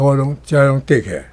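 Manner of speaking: normal talking voice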